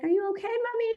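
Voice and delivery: put-on high, small child asking if mommy is okay; little kid voice. Mom imitating her little kids asking if she's hurt, high child voice. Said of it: "my little kids are just like".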